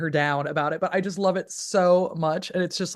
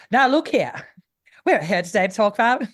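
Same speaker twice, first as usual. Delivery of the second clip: put-on higher, louder old Hollywood transatlantic; mock golden-age Hollywood announcer, transatlantic; transatlantic accent; 4 semitones higher, 4 dB louder than usual. Goofing on old school Hollywood, transatlantic accent. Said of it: "I love the transatlantic".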